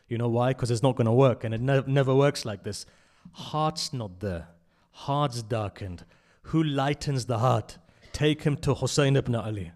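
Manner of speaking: loud, fervent sermon voice driving each line home; full-force preaching. Acted. Preacher telling the congregation lectures won't work, loud fervent preaching. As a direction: preach it hard, hammering the point to the hall.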